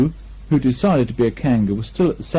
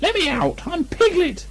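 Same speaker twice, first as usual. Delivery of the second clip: very high, slow squeaky mock shout; a tiny pig trying to yell; Piglet's small outraged cry. Piglet in bath.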